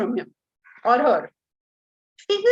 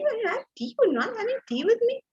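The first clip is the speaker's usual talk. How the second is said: sweet offer; 10 semitones higher than usual. Retold or imitated. Wife asking her sleepy husband to share morning tea. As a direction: offer it hopefully.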